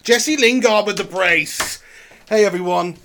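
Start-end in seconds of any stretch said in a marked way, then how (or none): 0.0-1.8 loud, jubilant goal cheer; goal celebration shout. Real, not acted. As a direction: yell it out like the winner just went in.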